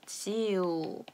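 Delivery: 브라지우, L at the end said as 우; 브라지우 with a soft 우 ending, not 브라질; Portuguese final L. Shown as a demonstration. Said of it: "이렇게 발음하게 됩니다". (끝소리 L을 우로 발음한 브라지우)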